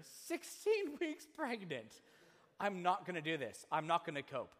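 rising, raw distress, slowed; pleading near tears; distraught wife. Retold or imitated. Retelling his exhausted wife near tears. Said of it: "Karen just starts to cry".

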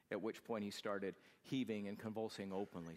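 normal talking voice

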